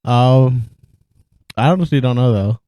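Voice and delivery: talking around ice; words round a piece of ice; ice in mouth. Real, not acted. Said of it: "I'm eating ice right now".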